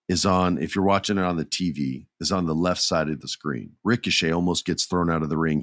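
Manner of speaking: ordinary talk, nothing marked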